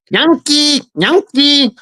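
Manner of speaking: high singsong call of Yankee; mock Filipino call. Guest imitates locals calling him a Yankee. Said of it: "they're calling it out to everybody".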